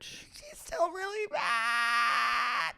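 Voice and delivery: very high wailing sob, slow; hammed-up falsetto sob; mock crying. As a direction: wail it like a melodramatic crier. Mocking Sheena's crying.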